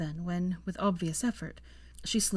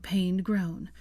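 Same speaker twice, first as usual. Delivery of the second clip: ordinary delivery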